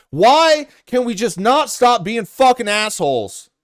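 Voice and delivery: usual speaking voice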